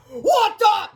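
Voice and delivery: very high, loud cry; shocked scream. A narrator's reading. Principal inside the restroom, screaming. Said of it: "screamed Mister Krupp from inside the restroom".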